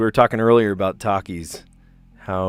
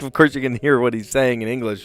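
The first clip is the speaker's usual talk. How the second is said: ordinary talk, nothing marked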